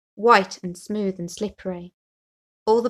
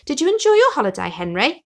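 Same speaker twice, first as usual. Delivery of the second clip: high, quick curious question; eager question; 6 semitones higher, 6 dB louder than usual. Acted. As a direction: bounce the question at a friend. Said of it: "asked Splash".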